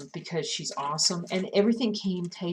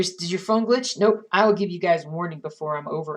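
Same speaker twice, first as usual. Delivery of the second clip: ordinary delivery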